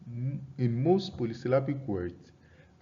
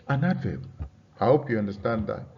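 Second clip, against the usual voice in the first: normal talking voice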